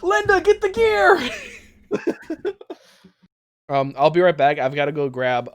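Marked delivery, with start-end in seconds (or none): 0.0-2.5 high, slow mock-heroic cry; dramatic slow call. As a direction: call out to Linda, drawn out and laughing. Joking call for action.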